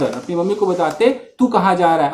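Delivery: normal talking voice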